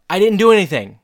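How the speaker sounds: high, loud protest; hammed-up panicked denial; mock defensive. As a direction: blurt out innocence like an accused husband. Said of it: "starts to get really defensive".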